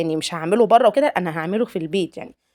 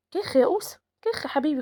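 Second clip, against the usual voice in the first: gentle scold; 6 semitones higher, 3 dB quieter than usual. Real, not acted.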